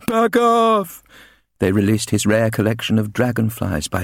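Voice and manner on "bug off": put-on high, loud jeering kid voice; screamed notice. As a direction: fling it like a rude sign. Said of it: "notices on his front door that screamed".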